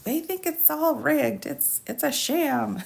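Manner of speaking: slow, high mocking voice for him; mock impression. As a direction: pitch way up and drag it out to mock him. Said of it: "And he's like".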